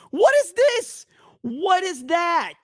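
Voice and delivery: high, loud, stunned outcry; real shock, voice jumping up; shocked disbelief. Real, not acted. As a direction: blurt it out in total disbelief. Reacting in shock to a clip.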